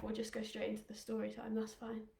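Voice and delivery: winded, breathless and trailing off; out of breath. Real, not acted. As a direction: talk while catching your breath. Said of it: "'cause I'm a bit out of breath".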